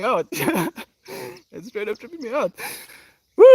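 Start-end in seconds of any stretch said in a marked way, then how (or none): 3.4-3.5 real delight spilling out as a whoop; ecstatic whoop. Real, not acted. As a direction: whoop that bursts into a high, loud laugh. Ecstatic greeting on stream.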